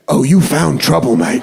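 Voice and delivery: put-on cocky drunk British threat; mock British pub tough guy; British accent. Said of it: "very drunk British guy".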